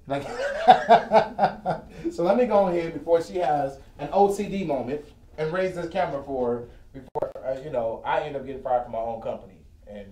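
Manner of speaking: ordinary delivery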